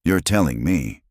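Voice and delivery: plain reading voice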